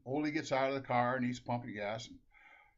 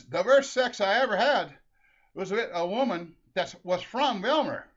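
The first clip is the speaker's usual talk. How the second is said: put-on raised, loud Norwegian-accented voice; 7 semitones higher, 8 dB louder than usual. Stranger at gas pump confides in Ole, Norwegian accent. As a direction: joke teller's broad Norwegian accent. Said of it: "I forgot the Norwegian accent".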